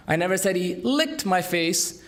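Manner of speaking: "licked" punched, the rest light; stress on LICKED. Shown as a demonstration. Speaker demoing word stress to an audience, hitting "licked". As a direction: make "licked" the odd action out. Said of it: "emphasize different words".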